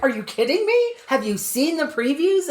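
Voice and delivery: high, emphatic comeback, slowing down; retold incredulity. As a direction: act out an incredulous retort. Retold incredulous comeback to scornful person.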